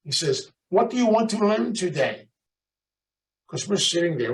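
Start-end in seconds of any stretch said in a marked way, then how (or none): 0.7-2.3 put-on high-pitched European accent; foreign accent. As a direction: ask it like a foreign teacher with an accent. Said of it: "He had an accent".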